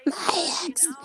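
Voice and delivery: high, creepy whisper of Gollum. Retold or imitated. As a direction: croon it like Gollum. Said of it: "Sounded like Gollum".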